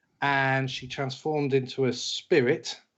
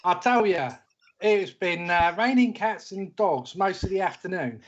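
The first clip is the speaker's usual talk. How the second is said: put-on British accent; 6 semitones higher than usual. Player introduces his character in a British accent. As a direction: greet them like an old Londoner. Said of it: "put on these outrageous British accents".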